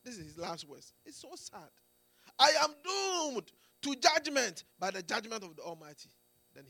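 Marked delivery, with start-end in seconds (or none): 2.4-6.1 loud, doomed exclamation; exclaiming. Retold or imitated. Preacher quotes the dying man's last words, loud exclamation. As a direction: cry it out as a man facing judgment. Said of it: "He exclaimed".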